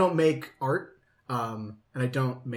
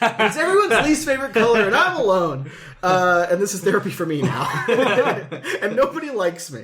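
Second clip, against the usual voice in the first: laughing mock self-pity; 6 semitones higher, 6 dB louder than usual. Real, not acted.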